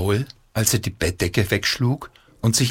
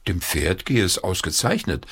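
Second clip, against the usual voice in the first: usual speaking voice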